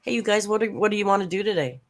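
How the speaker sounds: chirpy, rushed fake welcome; mock streamer greeting. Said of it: "turned on the camera and said".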